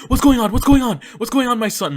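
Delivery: high loud worried cry; alarmed parent shout. Retold or imitated. Dad finding his son limping, alarmed shout.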